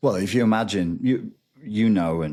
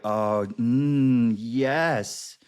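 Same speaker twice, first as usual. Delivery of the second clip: higher, slow smug purr; smug narcissist; 7 semitones higher, slower than usual. Retold or imitated. Narcissist Frank gloating over her distress, higher smug voice. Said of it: "they're like".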